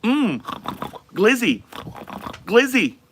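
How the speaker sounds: high, looping canned reactions; NPC bot voice. Acted. Streamer acting as an NPC bot reacting to viewer gifts. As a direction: repeat each word like a streaming NPC. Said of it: "You guys wanna try a bot stream".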